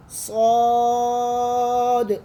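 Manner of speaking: स्वाद held long, then a sudden jolt at the end; swaad with a jerk. Shown as a demonstration. Teacher demonstrating the jerked ending of स्वाद. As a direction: stretch स्वाद and snap its end. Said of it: "एंड में थोड़ा सा आपने झटका देना है".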